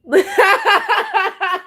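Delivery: sudden high cackle; high cackling laugh. Real, not acted. Laughing at God voice.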